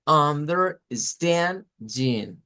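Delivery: understanding very slow, stand pushed up and held; slow teaching read of understanding; stress on stand. Shown as a demonstration. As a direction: spell out understanding syllable by syllable, leaning on stand. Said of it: "traten de seguirme con las manos".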